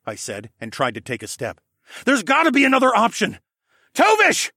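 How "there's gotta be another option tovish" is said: high, loud plea rising to a shouted name; plead, then scream the name higher; desperate cry. A narrator's reading. Alex pleading to save Tovish, desperately loud. Desperate call to doomed friend.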